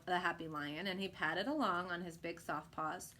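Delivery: ordinary delivery, nothing marked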